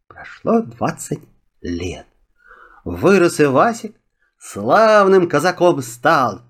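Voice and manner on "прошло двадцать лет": ordinary delivery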